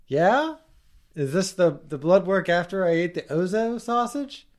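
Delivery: patient voice. Pretend. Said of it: "I'll do both voices".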